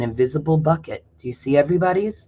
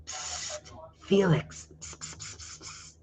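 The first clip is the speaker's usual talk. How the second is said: sneaky whisper; 2 semitones higher, 14 dB quieter, slower than usual. Acted. Classmates whisper behind Felix's back, in a whisper. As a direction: hiss it like gossiping kids.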